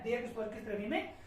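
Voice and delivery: ordinary delivery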